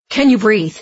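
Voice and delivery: loud, urgent question; urgent alarm. A narrator's reading. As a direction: check on someone hurt, in alarm. Dex urgently checks she is breathing.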